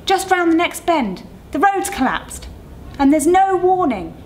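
calling out. Acted. Other driver shouts the danger to the coach, loud. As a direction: keep calling the warning across. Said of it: "the other driver called".

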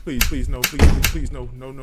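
desperate begging, voice strained; anguished denial. Real, not acted. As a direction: plead for your life over and over. Chased in horror game.

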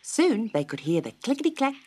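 ordinary delivery, nothing marked

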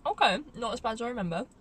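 biting into chewy candy; chewing licorice while talking. Real, not acted. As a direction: mouth partly full of candy. Seen 0: Holds licorice piece while talking, brings it to mouth at 1.1–1.3s.

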